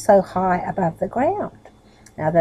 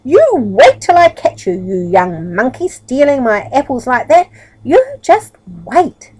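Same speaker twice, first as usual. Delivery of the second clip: furious farmer shouting much louder and higher; angry threat; 5 semitones higher, 9 dB louder than usual. Acted. Farmer threatens the apple-stealing boys, furious shout. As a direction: roar it at the thieving boys. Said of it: "the farmer's angry voice".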